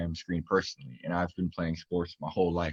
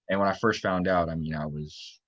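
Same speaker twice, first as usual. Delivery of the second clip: usual speaking voice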